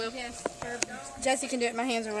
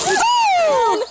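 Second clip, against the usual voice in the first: excited whoop; 11 dB louder than usual. Real, not acted. Jumping into the pool.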